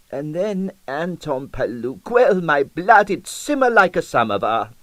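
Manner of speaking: put-on scornful old Russian dancer; disdainful, rising indignation; Russian accent. A narrator's reading. Dancer scorns rival's technique.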